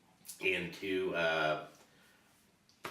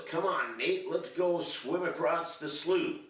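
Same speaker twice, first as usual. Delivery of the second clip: higher, keen friend's invitation; eager buddy's call; 9 semitones higher than usual. Acted. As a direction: call him over like a cocky swimmer. Friend urging him to swim.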